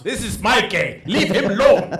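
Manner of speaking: hammed-up protective plea; mock possessive outcry. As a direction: jokingly shout them away from your friend. Mock protective act, laughing.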